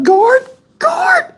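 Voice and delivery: very high, tearful call for help; scared crying. Retold or imitated. Scared cellmate calling the guard, high crying call. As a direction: imitate a panicked cry for the guard. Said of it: "The dude was scared as hell".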